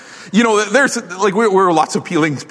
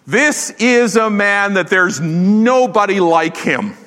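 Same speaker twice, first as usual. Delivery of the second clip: slow and earnest, lightly voiced; a hint of Paul praising his protégé; admiring Paul; 6 semitones higher, slower than usual. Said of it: "And yet Paul says".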